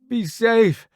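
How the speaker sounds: high, loud call. A narrator's reading. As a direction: shout a parting caution to a friend. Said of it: "Roddy calls out from behind me".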